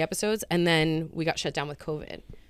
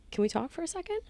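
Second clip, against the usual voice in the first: director asking gently; 12 semitones higher, 6 dB quieter, faster than usual. Retold or imitated. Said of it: "he was like".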